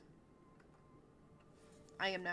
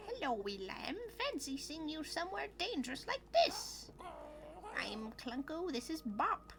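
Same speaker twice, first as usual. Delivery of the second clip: lightly voiced game character, lilting and animated; higher, sing-song character voice in places; Clunko; 7 semitones higher than usual. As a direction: read it as a chatty character greeting a traveler. Voices game's dialogue.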